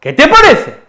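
"¿Qué te parece?" con subida aguda en qué y caída en parece; entonación animada. Shown as a demonstration. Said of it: "Fijaos, repetid conmigo". (entonación de ánimo muy aguda)

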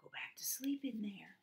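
very soft, apologetic whisper; hushed storybook apology. A narrator's reading. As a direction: whisper gently to a sleeping baby. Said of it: "whispered softly".

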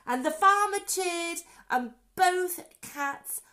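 high, loud, celebratory storytelling; jubilant narration. A narrator's reading. Narrator telling children the farmer cheered, high and jubilant. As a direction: lift the voice like a cheer.

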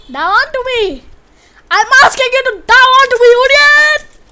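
shouting orders at a useless teammate; angry shout. Real, not acted. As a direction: yell the order in frustration.

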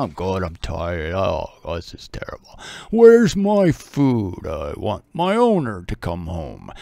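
low, slow, tired dog moaning; weary dog voice. Retold or imitated. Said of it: "they feel like crap right now".

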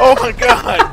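high loud disbelief; excited exclamation. Real, not acted. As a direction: cry out in disbelief. Reacting to chaos.

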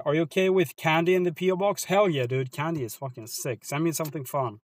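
usual speaking voice

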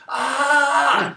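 loud, high mock scream; sudden hammed-up scream; imitated man's scream. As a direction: yell out as if overwhelmed. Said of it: "He starts screaming".

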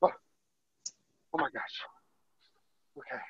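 breathless whispered oh my gosh, very slow; whispered relief. Real, not acted. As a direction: whisper it out, spent from the cold. Back inside, relieved.

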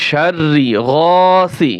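শাররি গাসি drawn out slowly, with a lengthened গা; slow recitation. Shown as a demonstration. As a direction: stretch গা by one alif. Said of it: "এক আলিফ টান হবে এখানে". (ধীরে টেনে তেলাওয়াত)

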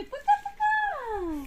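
put-on high, slow, laughing baby-talk bye-bye; slow high sing-song goodbye with a giggle; voicing the baby. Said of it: "Мамі каже".

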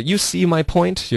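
as if checking they get it; seeking agreement. Retold or imitated. Co-host voicing the character who wants agreement, earnest appeal. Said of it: "有这种感觉".

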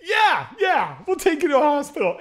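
high, loud, hurried yes; eager shout. Retold or imitated. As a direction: rush to agree, laughing at yourselves. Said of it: "and we were like".

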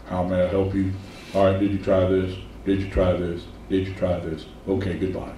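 dull monotone call-center voice, low and soft; flat bored drone. Retold or imitated. Bored call-center worker answering a customer in a dead monotone. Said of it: "at least put a little inflection in your voice".

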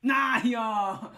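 loud, high frustrated groan; loud exclamation. Real, not acted. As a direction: blurt it out loud. Fails jump in game. (lauter genervter Ausruf)

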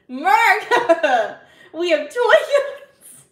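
loud, high, drawn-out through laughter; laughing outburst. Real, not acted. Streamer boasts America has toy guns, loud laughing outburst. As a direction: burst out laughing mid-boast. Cracking up during mock patriotic boast.

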